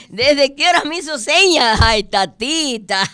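loud, amused outcry amid laughter; laughing exclamation. Real, not acted. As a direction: exclaim loudly while recovering from a laughing fit. Habla entre carcajadas. (exclamado fuerte, muerta de risa)

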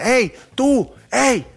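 shouted hey. Retold or imitated. Passerby shouting hey at a stranger, high mock yell. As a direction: yell at someone to turn. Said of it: "si gritan".

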